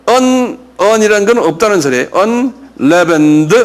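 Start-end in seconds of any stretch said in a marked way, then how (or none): 2.1-3.5 "Unleavened" said very slowly as "언레븐드"; slow pronunciation model. Shown as a demonstration. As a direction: spell out "Unleavened" slowly for learners. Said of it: "이건 좀 발음이 힘들어요".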